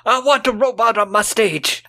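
high, excited [name] voice; [name] impression.

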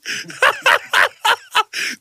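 very high, loud staccato laugh; real cackle. Real, not acted. Host cracking up at the Rikishi joke, high loud cackle. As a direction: burst into high, pulsing cackles.

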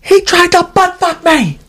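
very high imitated wail of protest; emotional, with conviction. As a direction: cry it out high, with full conviction. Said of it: "it's the way he's saying it".

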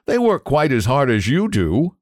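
usual speaking voice

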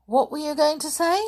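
high-pitched puzzled question; surprised. A narrator's reading. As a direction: ask it in surprise, pitched well up. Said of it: "looking surprised".

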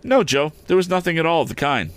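put-on high, earnest boy's denial; confessing boy. A narrator's reading. Boy denying his tale to Joe, confessing.

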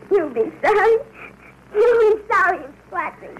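tearful threat through sobs; crying. Acted. Boy hit by his father threatens him through tears, crying. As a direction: cry it out, hurt and defiant.

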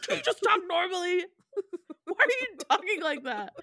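mock-protest to dad while giggling; laughing plea.

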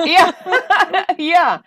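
loud, high laughing agreement; laughing yeah. Real, not acted. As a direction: laugh through the yes. Laughing at friend's reenactment.

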